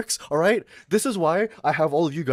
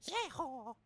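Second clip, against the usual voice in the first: mock Southern yeehaw; 12 semitones higher, 15 dB quieter than usual. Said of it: "'Cause you keep doing a Southern!".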